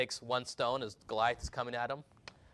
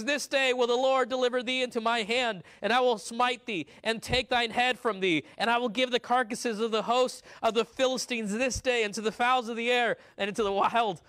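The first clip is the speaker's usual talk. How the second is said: put-on young David's boast; 9 semitones higher, 6 dB louder than usual. David boasts to Goliath before the battle, high and loud. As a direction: declaim it like a bold boy warrior. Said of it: "Verse forty-six".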